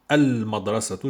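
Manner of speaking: al-madrasatu, L fully heard; L pronounced. Shown as a demonstration. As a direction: sound the L in "Al-Madrasatu". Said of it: "je prononce Al".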